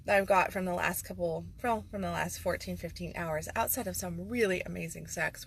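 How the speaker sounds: ordinary talk, nothing marked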